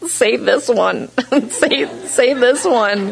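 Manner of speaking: high whimpery begging, half laughing; mock tearful pleading. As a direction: plead like someone needing comfort, hammed-up. Begging for comfort cards, acted.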